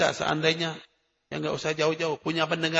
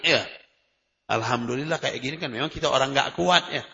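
normal talking voice

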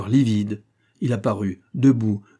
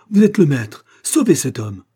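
loud, urgent plea starting high; pleading cry; 4 semitones higher, 7 dB louder than usual. A narrator's reading. Daughter begging her father to save the man, loud cry. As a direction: beg loudly for a life. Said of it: "s'écria l'Aurora".

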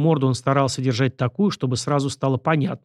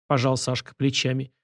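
usual speaking voice

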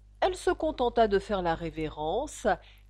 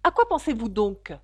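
raised-voice scolding question; stern; 4 semitones higher, 8 dB louder than usual. A narrator's reading. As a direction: demand an answer, louder and sternly. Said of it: "d'un air sévère". (question sévère, voix haussée)

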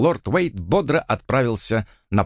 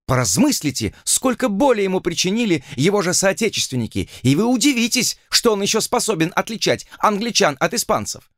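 put-on haughty young lady; 7 semitones higher than usual. A narrator's reading. Arabella voiced, raised pitch.

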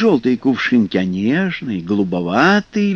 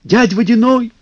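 calling out; 9 semitones higher, 3 dB louder than usual. Acted. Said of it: "подхватили Кузька с Лешиком".

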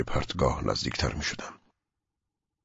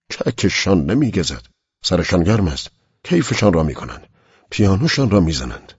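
louder, rising bitter rant; bitter anger; 5 semitones higher, 6 dB louder than usual. Acted. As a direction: spit out the resentment at the careless family. (با خشمی تلخ و صدایی بلندتر)

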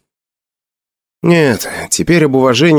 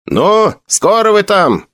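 calling out; 10 semitones higher, slower than usual. Acted. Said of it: "через дверь закричал".

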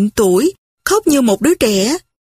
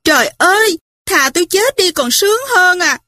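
put-on a sobbing young widow crying out in despair; anguished cry; 8 semitones higher than usual. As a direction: wail it out like a spoiled girl. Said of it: "nàng hét lớn".

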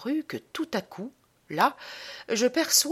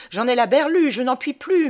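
louder, higher, exasperated cry; frantic outburst; 5 semitones higher, 6 dB louder than usual. Acted. Man storms out of the salon, frantic outburst. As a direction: burst out at your wits' end. Frantic at his wits' end.

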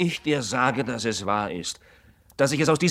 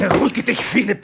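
higher and louder urging to calm down; raised plea; 7 semitones higher, 5 dB louder than usual. Acted. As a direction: raise your voice to stop him. Friend tries to calm him.

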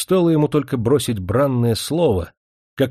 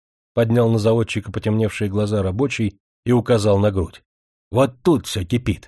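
ordinary delivery, nothing marked

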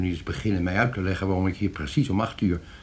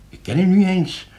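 indignant protest; 11 semitones higher, 6 dB louder than usual. Acted. Gast protesteert tegen vreemde man.

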